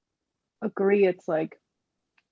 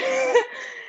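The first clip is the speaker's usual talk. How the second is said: high shrieking laugh. Retold or imitated. Acting out aunts laughing.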